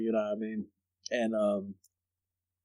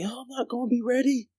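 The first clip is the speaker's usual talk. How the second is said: mock childhood panic; 14 semitones higher than usual. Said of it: "'Cause I was like".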